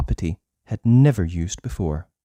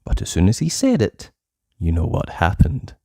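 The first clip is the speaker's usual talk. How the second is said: ordinary delivery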